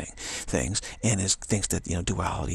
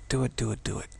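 ordinary delivery

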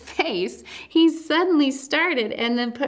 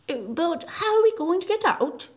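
Tumnus's question; 9 semitones higher than usual. Acted. Character asks, high voice.

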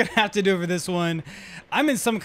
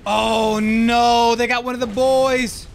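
alarmed; 5 semitones higher, 6 dB louder, much slower than usual. Real, not acted. Teammate killed in game.

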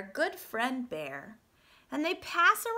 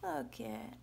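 usual speaking voice

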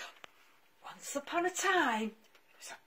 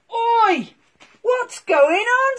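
loud cross oi; daddy polar bear woken up; grumpy shout; 11 semitones higher, 14 dB louder, slower than usual. Acted. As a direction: yell at the intruder. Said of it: "said the daddy polar bear".

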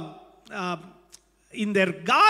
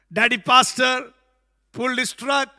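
ordinary delivery

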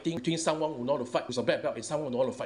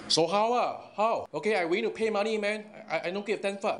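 angry challenge; 7 semitones higher than usual. Real, not acted. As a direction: dare him, voice rising in anger. Heated rant at online troll.

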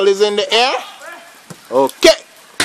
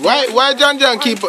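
usual speaking voice

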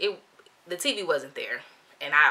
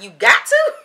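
loud, higher-pitched disbelief; incredulous; 5 semitones higher, 14 dB louder, slower than usual. Retold or imitated. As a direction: blurt the question out in amazement. Mock disbelief at forced discount.

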